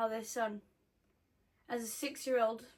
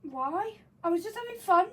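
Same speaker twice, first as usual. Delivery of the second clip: higher, louder, injured whine; sulky, higher whine of fun; whiny defense; 6 semitones higher, 4 dB louder than usual. Real, not acted. Defending the accent.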